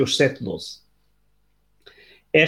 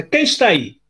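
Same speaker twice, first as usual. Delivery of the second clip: calling out; 6 semitones higher, 3 dB louder than usual. Acted. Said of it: "perguntou em voz alta".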